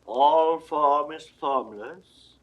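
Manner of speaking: ordinary talk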